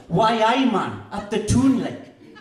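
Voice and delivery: loud, high hammed-up Geordie accent. Poet doing accents for laughs, loud mock Geordie. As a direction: shout it like a bad Geordie impression. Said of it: "I canna do accents".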